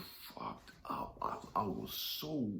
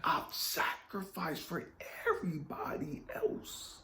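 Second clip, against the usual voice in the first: very high breathy whisper; 9 semitones higher than usual. Retold or imitated. Complaint to God, whispered.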